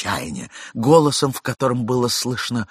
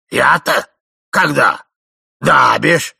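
put-on slow, louder old man; doddering old man; 5 semitones higher, 8 dB louder, much slower than usual. A narrator's reading. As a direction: mumble on forgetfully.

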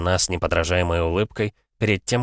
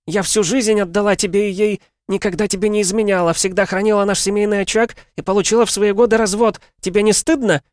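pathetic exclamation; 6 dB louder than usual. A narrator's reading. Wife pleading in melodrama.

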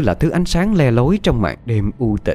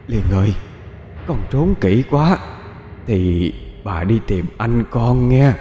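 slow, eerie, coaxing call of a ghost; eerie luring voice; slower than usual. Acted. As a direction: lure the child out, slowly. Ghost grandmother lures the girl. (giọng ma dụ dỗ chậm rãi)

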